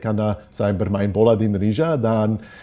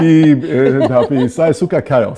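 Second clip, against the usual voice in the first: laughing; 6 semitones higher, 8 dB louder than usual. Real, not acted. Laughing, loves the chaos.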